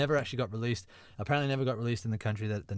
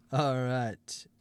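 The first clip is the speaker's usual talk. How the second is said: ordinary delivery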